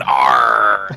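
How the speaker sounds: loud, drawn-out pirate cry; hammy pirate roar; pirate growl. Acted. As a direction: bellow it like a stage pirate. Pirate noise for eye-patch suggestion.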